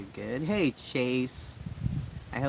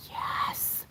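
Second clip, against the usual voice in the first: whispered yes; 4 dB quieter than usual. Real, not acted. Reaction to good news.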